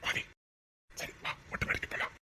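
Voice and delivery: very soft, secretive whisper; barely audible, voiceless invitation. Acted. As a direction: whisper it for one person only.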